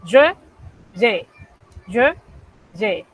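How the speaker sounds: je closed then ye open, alternating; closed je, open ye drill. Shown as a demonstration. Teacher drilling students on je and ye. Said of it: "los invito a que lo practiquen".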